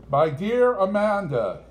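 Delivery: a hint of a pompous lawyer intoning. A narrator's reading. Family lawyer lecturing Amanda, lightly intoned. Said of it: "intoned the family lawyer".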